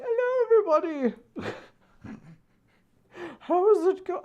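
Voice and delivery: put-on high, loud quavering old granny greeting; mock elderly streamer; old lady streamer voice. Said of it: "I'm gonna be like".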